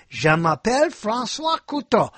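demonstrated French speech; French pronunciation. Shown as a demonstration. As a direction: show off a French self-introduction. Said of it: "How can I do that if I don't know French?".

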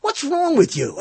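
high-pitched mock outrage; retold exasperation. Host retelling his words to the company woman, exasperated. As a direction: demand it in disbelief, voice shooting up. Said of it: "she actually got me mad".

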